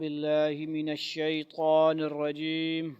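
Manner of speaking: slow chanted Quran recitation. Acted. Teacher opens class reciting slowly and reverently.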